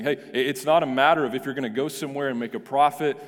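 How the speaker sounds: usual speaking voice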